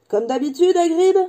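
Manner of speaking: lightly voiced barman. Barman greets a regular customer.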